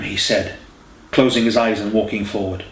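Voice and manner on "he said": ordinary delivery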